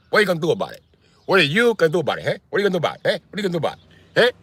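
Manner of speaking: high, needling Italian tough guy; insistent mock-Italian taunting; Italian accent. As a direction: challenge them in an Italian-American accent. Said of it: "Italian guys love saying that".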